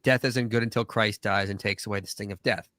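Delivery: ordinary delivery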